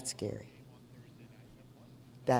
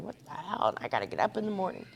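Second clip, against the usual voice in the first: imitating her old grumpy complaint, quieter; mock grumble at being woken; grumbling; 4 dB quieter than usual. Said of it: "'Cause I used to go up there grumbling".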